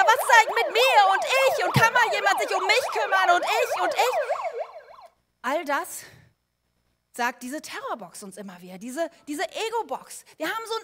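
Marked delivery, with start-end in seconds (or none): 0.0-4.9 high, loud, self-pitying whine; whiny ego voice. Acted. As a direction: play the demanding inner ego box crying for attention. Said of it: "Und die sagt immer". (hohe, laute, jammernde Ego-Stimme)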